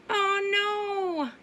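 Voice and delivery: usual speaking voice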